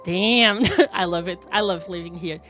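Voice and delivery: ordinary delivery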